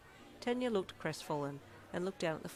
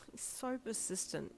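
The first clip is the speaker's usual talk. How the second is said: slow, higher, softer annoyance; annoyed snap; 6 semitones higher, 4 dB quieter, slower than usual. A narrator's reading. Listener complaining to Tansy about a suitor, annoyed snap. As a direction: draw it out in exasperation. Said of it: "you snapped".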